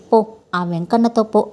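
plain reading voice

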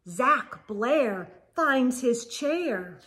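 usual speaking voice

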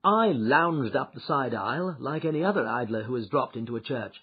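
ordinary delivery, nothing marked

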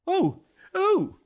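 very high, loud frightened gasps; startled gasps. Acted. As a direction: gasp in fright. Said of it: "the woman gasped".

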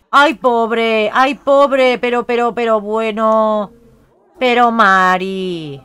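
loud, dragged-out cries of pity; slow loud lament; loud dismay. Real, not acted. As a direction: wail in real dismay. Sim wets herself in game.

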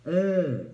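high, loud appreciative mm; savoring wine hum. Retold or imitated. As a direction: savor the sip. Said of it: "She be like".

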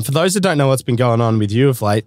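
normal talking voice

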